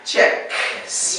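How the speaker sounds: checks with a sharp K before the s; model pronunciation. Shown as a demonstration. Teacher models checks for the student, clear ks. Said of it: "can you hear the".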